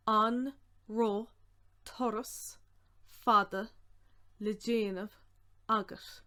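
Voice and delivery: word by word with long gaps; careful, spaced-out dictation; slow breakdown. Shown as a demonstration. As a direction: sound out each piece for learners.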